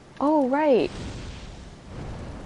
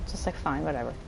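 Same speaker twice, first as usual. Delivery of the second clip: mumbled dismissal; 5 semitones lower, 11 dB quieter than usual. Retold or imitated. Voicing wyvern dismissive.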